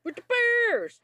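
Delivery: mock yell. Friend joins in yelling at the screen, shrill playful echo.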